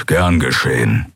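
low offhand growl. A narrator's reading. Keru shrugs off Endriel's reproach, growling. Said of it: "knurrte er mit einem Achselzucken".

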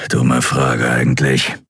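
low, slow grumble; deep, slow self-muttering; grumbling to himself. A narrator's reading. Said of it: "brummte dann zu sich selbst".

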